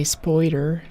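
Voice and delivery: put-on spoider with an oi sound, like boy. Shown as a demonstration. Streamer teaching chat the local spoider. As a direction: stretch out spoider, oi not i. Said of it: "not spider".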